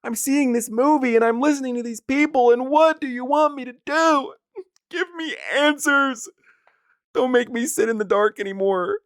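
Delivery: theatrical desperate prayer; mock prayer to God. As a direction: beg heaven for answers, hammed up. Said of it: "I looked up and I said".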